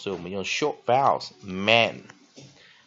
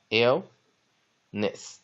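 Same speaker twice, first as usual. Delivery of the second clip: illness drawn out, ILL stressed, ness falling away; teacher modelling illness with first-syllable stress. Shown as a demonstration. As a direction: say illness very slowly, hitting ILL. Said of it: "重音在第一个音节".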